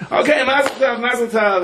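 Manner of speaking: high-pitched, sudden Mazel Tov; excited congratulations. Acted. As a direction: seal the deal with a jubilant cry. Deal struck, father agrees to the match.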